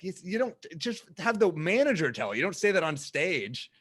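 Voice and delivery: mock indignant comic; exasperated. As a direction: scold him like he should know better. Comic scolding the owner.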